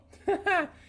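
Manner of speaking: high squealing laugh of delight. Real, not acted. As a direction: burst out in a high happy laugh. Sees painting finished, cheering.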